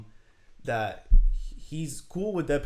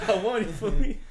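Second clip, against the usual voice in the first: laughing, higher and louder; 9 semitones higher, 6 dB louder than usual. Real, not acted. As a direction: crow it through a laugh. Repeats the boast, laughing.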